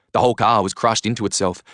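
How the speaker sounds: usual speaking voice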